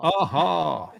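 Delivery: punchy catchphrase impression; Alan Partridge. Host echoing the guests' joke, Alan Partridge impression. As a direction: throw out a comic presenter's catchphrase. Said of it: "Alan Partridge".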